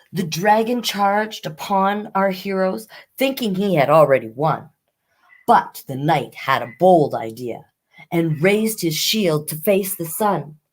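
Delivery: lively rhyming read-aloud; reading a rhyming fairy tale to children; storybook narration. Acted. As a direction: tell the fairy tale to young listeners.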